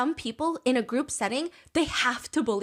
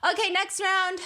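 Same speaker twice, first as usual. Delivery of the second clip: higher-pitched mock brush-off; dismissive interruption; 7 semitones higher, slower than usual. Zizi cutting in before someone talks, dismissive. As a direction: cut them off and move things along.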